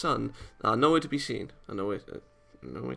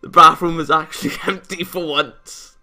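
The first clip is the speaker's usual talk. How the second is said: laughing, much higher and louder, near tears of joy; overjoyed; 6 semitones higher, 8 dB louder than usual. Acted. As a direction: gush with giddy delight. Overjoyed at the empty bathroom.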